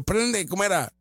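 ordinary delivery

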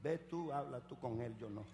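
low, soft, shrinking reply; timid coward. Acted. Coward sending someone else to confront, low timid voice. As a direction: duck out of it in a small, quiet voice.

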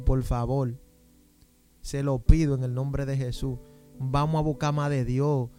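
ordinary talk, nothing marked

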